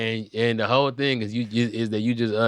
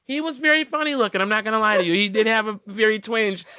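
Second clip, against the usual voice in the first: high, mock-earnest admission; candid onlookers; 10 semitones higher than usual. Passengers telling the guy the truth, high mock-candid voice. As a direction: confess it like a nosy passenger.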